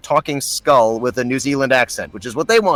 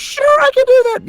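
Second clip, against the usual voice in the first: high-pitched, louder "sure thing" act; breezy bluff; 5 dB louder than usual. Retold or imitated. Actor bluffing that he can do a Kiwi accent, breezy bluff. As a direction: sell a bluff with squeaky eagerness. Confident bluff quoted after accepting a role.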